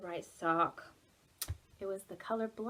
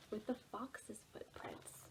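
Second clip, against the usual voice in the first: soft unvoiced whisper; hushed storybook whisper; 15 dB quieter than usual. A narrator's reading. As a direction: whisper the answer secretly. Reading to children.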